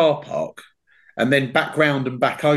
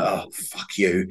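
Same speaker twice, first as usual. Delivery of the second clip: muttered retort; 7 semitones lower, 5 dB quieter, much slower than usual. Retold or imitated. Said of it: "So I'm like".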